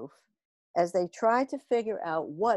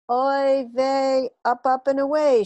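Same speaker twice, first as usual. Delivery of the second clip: loud, higher heroic cry; heroic shout; 5 semitones higher, 6 dB louder than usual. A narrator's reading. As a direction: launch into the sky with a shout. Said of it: "she shouted".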